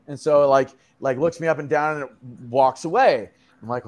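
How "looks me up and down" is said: ordinary delivery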